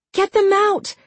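high-pitched, urgent cry. A narrator's reading. Jessica ordering the children out from the creature, urgent cry. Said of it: "Jessica cried".

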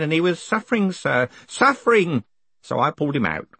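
put-on high-pitched old man recounting a mishap; old underling reporting up to his boss, pitched high; old postal worker, earnest. Grote explains the lad's search to his boss, high old-man voice.

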